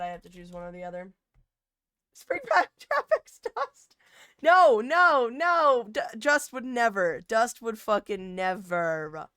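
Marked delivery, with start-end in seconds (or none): 2.1-9.3 outraged protest. Real, not acted. Outraged at chat suggestion.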